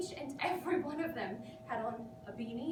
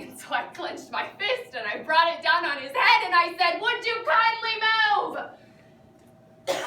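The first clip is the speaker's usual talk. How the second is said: furious outburst; 5 semitones higher, 10 dB louder than usual. Acted. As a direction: build from heated telling to a high, loud shout. Acted retelling of angry outburst.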